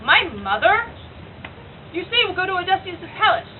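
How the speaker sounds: high, loud, scornful tragic heroine; indignant Cassandra. Acted. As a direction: challenge the herald with ringing stage outrage. Monologue that is done by Cassandra.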